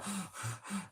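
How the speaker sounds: sudden gasp for air; sharp gasp. Shown as a demonstration. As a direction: mime fighting for breath. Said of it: "where I can't breathe".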